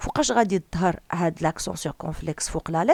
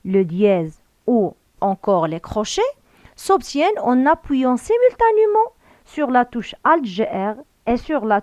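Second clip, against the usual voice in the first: slow, deliberate instruction reading; slow dictation read; 4 dB louder, much slower than usual. Acted. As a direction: read each French word out slowly and clearly, like dictating to a class. (قراءة تعليمية بطيئة ومتأنية)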